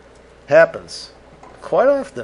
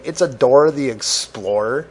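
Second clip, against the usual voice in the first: ordinary delivery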